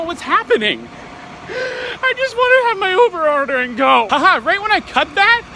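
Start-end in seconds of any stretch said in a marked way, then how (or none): 1.5-4.1 whiny fake cry. Real, not acted. Whining about closed McDonald's.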